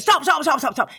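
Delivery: high urgent calls of stop; retold crew shout. Crew halts filming, shouting stop high. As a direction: cry stop to halt the take. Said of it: "They're like".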